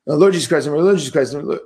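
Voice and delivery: imitating a hurried, gabbled prayer; mock rote recitation, words run together; mindless rote prayer. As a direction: rattle it off without meaning it. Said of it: "So it's not like".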